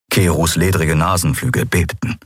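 ordinary delivery, nothing marked

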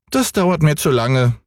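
hohe, quengelnde Kinderstimme; kleiner Junge schreit. Acted. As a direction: fordernd wie ein ungeduldiges Kind. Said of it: "sagte Häwelmann". (hoch und fordernd gerufen)

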